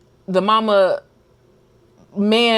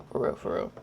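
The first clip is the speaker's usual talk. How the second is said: whispered aside; 9 dB quieter than usual. Real, not acted. Aside after opinion.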